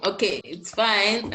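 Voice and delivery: ordinary talk, nothing marked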